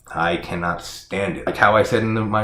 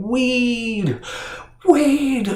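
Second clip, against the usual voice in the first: high-pitched mock scream, drawn out; imitated scream. Reviewer mocking the friend's scream for dead Weed, high mock scream. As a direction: mimic a friend screaming a name in a strained high voice. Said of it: "he's screaming".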